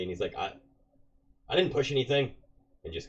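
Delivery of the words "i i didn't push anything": high, loud guilty protest; guilty denial. Acted. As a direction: blurt the denial like a caught kid. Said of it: "looks at you guiltily".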